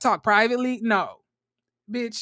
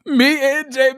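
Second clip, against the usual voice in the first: ordinary delivery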